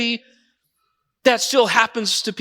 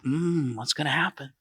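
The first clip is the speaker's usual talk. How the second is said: soft, lower musing with a hum; hushed suspense; 7 semitones lower, 11 dB quieter than usual. Retold or imitated. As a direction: hold your breath and murmur it. Crowd watching in suspense.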